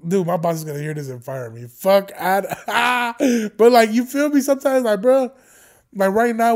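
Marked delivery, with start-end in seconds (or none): 1.7-6.5 high, loud, laughing through it; laughing outburst. Real, not acted. Host to viewers after cursing on air, laughing loudly. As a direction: burst out laughing and carry on loudly. Laughing at his job risk.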